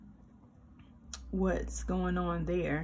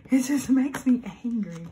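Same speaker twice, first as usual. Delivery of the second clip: half-laughing, louder angry restatement; louder angry repeat; 4 semitones higher, 8 dB louder than usual. Real, not acted. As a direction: repeat it louder, with real annoyance.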